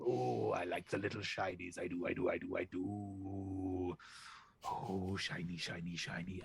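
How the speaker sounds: lightly voiced goose king, softly entranced. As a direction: croon at the shiny things.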